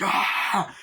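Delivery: sudden high yelp of fright; mock yelp at a ferret; a high hammed-up scream; startled shriek.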